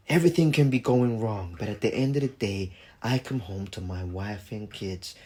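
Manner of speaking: low, soft sitcom closing line; motivational sign-off. Retold or imitated. Michael Kyle ending an episode, low soft motivational line. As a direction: wrap it up with a hushed homely moral. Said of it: "a little motivational, um, line".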